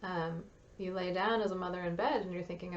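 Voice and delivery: ordinary talk, nothing marked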